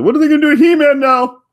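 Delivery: loud, high-pitched worried fan; hammed-up alarm; mock panic. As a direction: cry out like a fretting fan. Mimics nervous fans' outcry.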